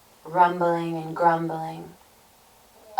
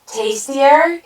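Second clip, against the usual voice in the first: loud, rising greedy questions; hungry troll suddenly tempted; eager cry; 8 semitones higher, 8 dB louder, slower than usual. A narrator's reading. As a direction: cry it out, pitch swooping up. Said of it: "he cried".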